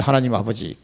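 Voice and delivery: doa lembut dan pelan, hampir berbisik di akhir; softly and slowly say thanks like a child to a father; tender prayer. Shown as a demonstration. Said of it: "이러면 나를 거듭나게 해주셔서 감사합니다".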